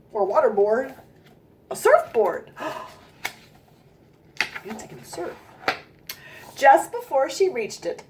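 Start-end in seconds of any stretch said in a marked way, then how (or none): 2.6-5.3 gasped, whispered realization; awed whisper. Real, not acted. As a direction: whisper it in amazement. Gasped discovery to child listeners.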